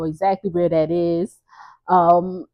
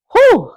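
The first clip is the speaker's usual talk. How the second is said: relieved whew; 13 semitones higher, 6 dB louder than usual. Real, not acted. Relief at family surviving fire.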